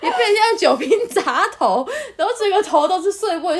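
high laughing, nearly crying. Real, not acted. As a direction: crack up laughing to tears. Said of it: "我就笑爆". (笑到眼泪都出来，声音很高)

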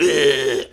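short, loud creature growl to frighten; menacing goblin snarl; goblin scare noise. Acted. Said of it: "trying to scare her".

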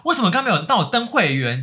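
loud, high furious demand; a customer yelling about membership; angry yell. Retold or imitated. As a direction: yell it at the cashier. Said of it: "超生气大吼说".

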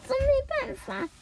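exasperated mother. Acted. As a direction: grumble like a mom whose kid ran off.

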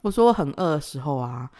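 normal talking voice

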